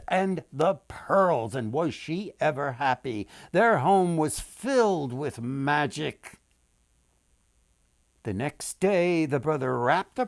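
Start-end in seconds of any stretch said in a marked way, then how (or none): none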